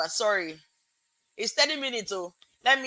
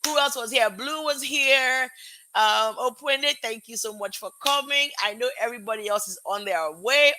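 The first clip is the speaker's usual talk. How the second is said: ordinary delivery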